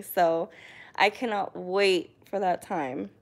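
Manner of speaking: usual speaking voice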